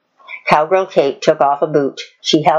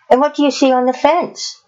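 higher and quick, a teasing quiz; Cowgirl Kate's voice; 10 semitones higher, faster than usual. A narrator's reading. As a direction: lead the horse to the answer brightly. Kate quizzing her horse.

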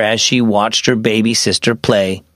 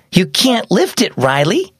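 put-on much higher-pitched, huffy girl; irritated huff; 7 semitones higher than usual. A narrator's reading. Older sister to baby Riley, irritated huff. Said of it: "Mia huffed".

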